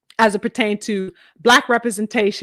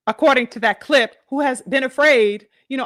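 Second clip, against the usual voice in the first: ordinary delivery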